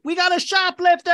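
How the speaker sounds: high-pitched mock alarm call. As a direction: sound the alarm as a panicked store clerk. Store clerk raising the alarm.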